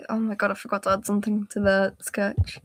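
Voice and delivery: ordinary talk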